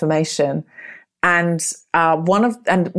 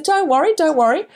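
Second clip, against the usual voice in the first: breezy reassurance; 8 semitones higher than usual. Retold or imitated. Imitating investigator's reassurance.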